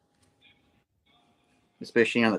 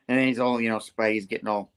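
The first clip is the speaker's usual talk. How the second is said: ordinary talk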